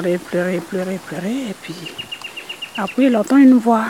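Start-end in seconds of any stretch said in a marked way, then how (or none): none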